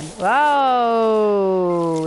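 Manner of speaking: loud surprised whoa; startled whoa. Real, not acted. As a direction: jump at the sudden sight. Sudden creature appears.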